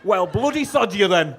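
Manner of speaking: loud, put-on Croydon accent; mock-offended Croydon accent, played for laughs. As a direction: hurl it at the crowd in your best Croydon. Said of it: "That's my best Croydon accent".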